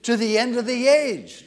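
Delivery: booming congregational read-aloud; loud scripture reading. Shown as a demonstration. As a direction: proclaim it to the congregation. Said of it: "Let's read it aloud together".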